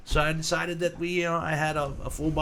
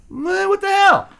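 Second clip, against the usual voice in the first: high, loud careless shrug; shrugging what the hell; 11 dB louder than usual. Retold or imitated. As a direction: toss it off, why not. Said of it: "So then I said".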